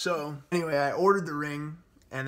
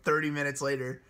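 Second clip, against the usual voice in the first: normal talking voice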